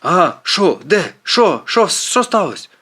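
high, flustered questions; jolted, alarmed confusion; startled. A narrator's reading. Startled driver answers the shouting.

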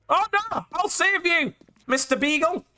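high-pitched, hammed-up rescue call; mock-heroic cry. Player rushing to save a beagle in game, mock-heroic cry.

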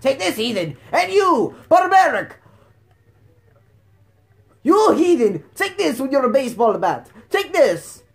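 loud, high hammed-up war cries; playful fighting yells; mock battle cries. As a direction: shout at enemies like an over-the-top hero. Battle cries at game enemies.

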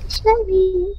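put-on high, soft girly denial. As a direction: deny it as a coy girl. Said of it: "He's trying to act like a girl".

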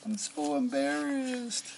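very high, slow drawn-out squeal; storybook child's melodramatic lament; mortified wail. A narrator's reading. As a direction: wail it high and stretch every word. Andrew's mortified cry in story.

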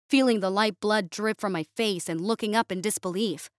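plain reading voice

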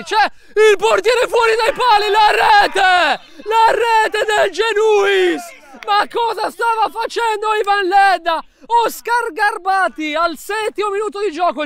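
high, loud, long goal cry; breathless italian goal commentary. Real, not acted. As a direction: roar the goal out. Goal scored into empty net.

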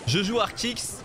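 mock outraged banter in a southern French accent. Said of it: "je prends l'accent du sud".

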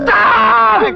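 high, drawn-out yell; commanding shout. Acted. Telling crying mourners to stop, commanding shout. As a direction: cut the wailing off with a shout.

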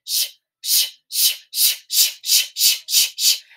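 repeated steam engine hissing; train hiss. A narrator's reading. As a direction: chuff out the locomotive's steam. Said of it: "The engine was saying".

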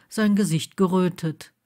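ordinary delivery

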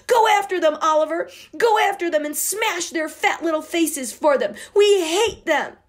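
put-on high, loud furious girl; furious yell. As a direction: scream for revenge. Girl urges brother to fight.

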